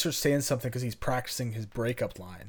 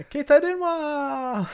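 much higher, slow, Keita Denwa stretched out; giddy echo; 13 semitones higher, 4 dB louder, much slower than usual. Retold or imitated. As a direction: repeat Keita Denwa in the same excited squeal. Echoing the hyped phone name.

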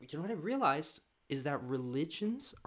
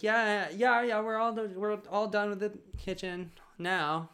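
nervous cover-up; 9 semitones higher than usual. Retold or imitated. Covering nervously for hidden mom.

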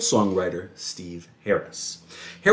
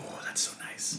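breathy whispered aside; whispering; 18 dB quieter than usual. Real, not acted. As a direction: whisper it dreamily. Wistful reply about salmon.

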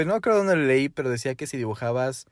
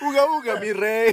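high loud caveman grunts; goofy caveman greeting; 6 semitones higher, 3 dB louder than usual. Pretend. Playing cavemen together.

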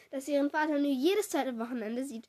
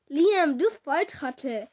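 exasperated call; 9 dB louder, slower than usual. A narrator's reading. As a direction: call out at a hopeless friend. Freundin schimpft lachhaft Festsitzenden aus. (lauter, genervter Ausruf)

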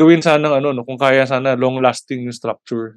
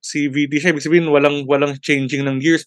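ordinary delivery

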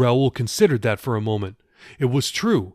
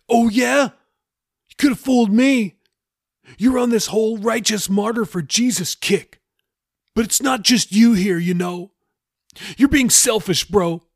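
high, loud, heated sarcasm; Jeffy, accusing; 11 semitones higher, 5 dB louder than usual. Acted. Jeffy accuses Raoul of playing martyr, heated and high. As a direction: lay into him for being selfish.

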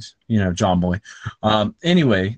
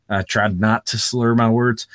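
ordinary talk

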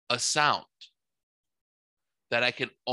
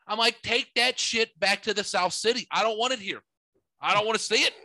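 higher, incredulous rant; worked-up rant; 8 semitones higher than usual. Retold or imitated. A man on his porch ranting to the neighbors, higher and incredulous. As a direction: rant at the sight, disbelieving.

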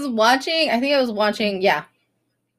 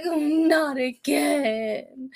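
very slow, high mock dread; drawn-out, pitched-up retold fear; scared groan; 4 semitones higher, 4 dB quieter, much slower than usual. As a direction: drag it out in comic dismay. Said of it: "I was so scared".

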